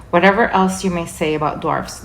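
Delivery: plain reading voice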